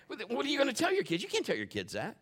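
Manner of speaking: ordinary talk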